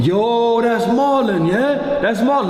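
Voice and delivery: a hint of a smug London lad; mock fan voice. The fan taunts Marlon on camera, high and cocky.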